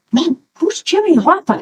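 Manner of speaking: high-pitched imitation of a baffled daughter; puzzled daughter. Daughter asks her mother who that is, puzzled. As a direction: ask Mom, voice lifted in puzzlement.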